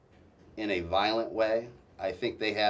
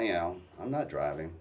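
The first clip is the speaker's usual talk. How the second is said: ordinary talk, nothing marked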